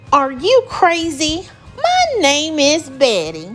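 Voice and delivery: loud, high indignant monster; mock furious. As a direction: snap back as an offended monster. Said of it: "stood up with a furious gaze".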